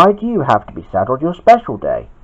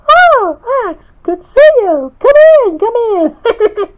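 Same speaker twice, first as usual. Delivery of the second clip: very high, loud falsetto welcome; piercing, giggly character voice; laughing Spinel; 5 dB louder than usual. A narrator's reading. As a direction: gush with a giggle at the end. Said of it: "laughed Spinel".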